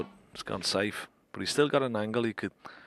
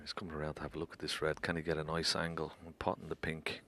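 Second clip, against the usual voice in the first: hushed, softer commentary; snooker commentary; 2 semitones lower, 4 dB quieter than usual. Acted. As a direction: keep it low while he plays. Hushed commentary during play.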